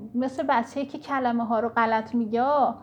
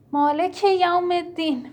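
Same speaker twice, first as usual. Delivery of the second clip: Quran recitation; 6 semitones higher, 6 dB louder than usual. Acted. Quran verse recited in prayer.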